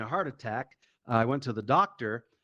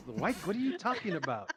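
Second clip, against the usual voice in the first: high, softer incredulous question; baffled dad; 5 semitones higher, 5 dB quieter than usual. Retold or imitated. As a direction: act out a dad in disbelief.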